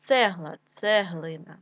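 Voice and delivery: ordinary talk, nothing marked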